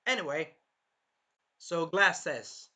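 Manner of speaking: ordinary talk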